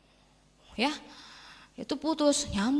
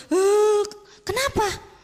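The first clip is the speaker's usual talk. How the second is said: startled retold reaction; 9 semitones higher, 5 dB louder, much slower than usual. Acting her startled reply to crying student.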